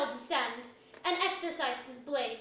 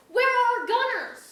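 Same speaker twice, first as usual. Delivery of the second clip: high, loud commanding cry; lady calls out for her missing gunners; angry demand; 7 semitones higher, 6 dB louder than usual. Acted. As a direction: demand it over the battle noise.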